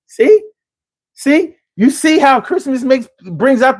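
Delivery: jabbing the point home, thrilled; excited. Real, not acted. Telling viewers Christmas brings out good, excited.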